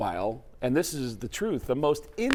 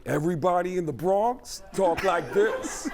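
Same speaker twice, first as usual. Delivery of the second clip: Tracy Morgan impression; 5 semitones higher, 5 dB louder than usual. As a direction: play Tracy Morgan loose and amused. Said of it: "sounds like Tracy Morgan".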